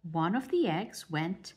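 usual speaking voice

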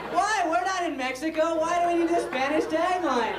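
high-pitched comic indignation; exasperated protest. Acted. Comedian objecting to the Spanish tagline, mock exasperation. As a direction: complain upward, baffled and put out.

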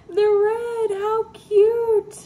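high, loud, delighted gush; excited high cry over little red headlights; thrilled squeal. Real, not acted. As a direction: squeal over a tiny detail you just found.

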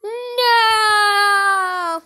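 drawn-out pretend cry of despair; long wailed no. Puppet watching Father go over the cliff, despairing. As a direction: wail it on one long falling note.

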